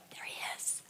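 whispered, creeping after Jesus; sneaky whisper. Retold or imitated. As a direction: hush it like you're sneaking up. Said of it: "they're like".

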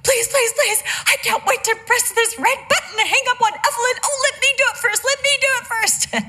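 grandma mimicking the granddaughter, hammed-up whine; mocking her whine. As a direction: beg back in her own bratty voice. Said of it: "I'm like".

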